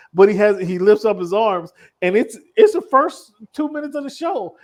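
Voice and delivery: ordinary talk, nothing marked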